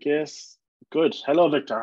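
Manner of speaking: normal talking voice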